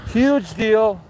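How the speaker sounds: high, emphatic Trump boast; sharp, excited Trump impersonation; Trump impression. Shown as a demonstration. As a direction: punch it out like a Trump soundbite. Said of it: "Trump impression".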